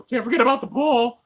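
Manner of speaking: high, loud put-on bull voice. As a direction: bellow it out like a bull. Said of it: "Gotta do my bull voice".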